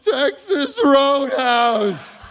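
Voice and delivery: high, drawn-out comic wail; hammed-up tearful cry, slow; mock wailing confession. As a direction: wail it guiltily, long and high. Father wails back his answer.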